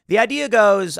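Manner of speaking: usual speaking voice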